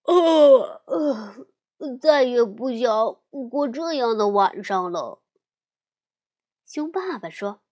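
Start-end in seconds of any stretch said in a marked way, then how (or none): 0.1-5.2 yawning. Acted. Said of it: "小熊哥哥打着哈欠说".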